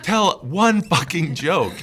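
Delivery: high, loud, drawn-out mock yell; imitating screaming heckler. Comic repeating the heckler's demand, slow mock scream. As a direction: lean on each word, yelling like a heckler. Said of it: "this guy just starts screaming".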